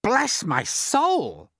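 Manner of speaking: high, loud, very slow exclamation; astonished cry. A narrator's reading. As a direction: cry out amazed, stretching each word. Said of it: "cried the head".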